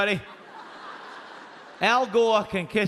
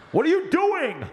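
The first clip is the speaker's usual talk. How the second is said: indignant manager; 9 semitones higher than usual. Retold or imitated. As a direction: play the fed-up McDonald's manager. Angry manager confronts them.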